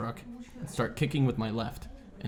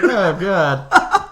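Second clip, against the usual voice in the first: laughing dismay; 7 semitones higher, 9 dB louder than usual. Retold or imitated. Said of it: "I'm like".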